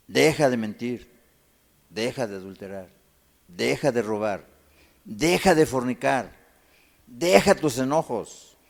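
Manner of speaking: loud, slow commands, each one hammered; forceful preaching. Acted. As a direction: command them to quit, one sin at a time. (exhortación fuerte y lenta, golpeando cada orden)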